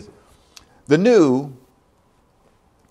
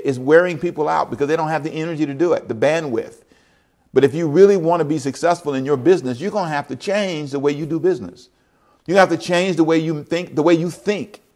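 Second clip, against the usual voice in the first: rising sermon intensity; 5 semitones higher than usual. Acted. Pastor urges his church to change, fervent preaching. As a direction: build the call for change.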